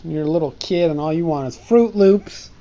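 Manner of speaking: usual speaking voice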